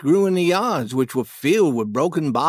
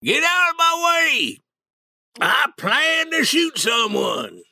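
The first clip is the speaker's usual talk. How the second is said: put-on high, loud drunken bellow; drunken shout; 11 semitones higher than usual. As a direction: roar it from horseback, drunk. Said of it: "shouting and a-calling in a loud voice".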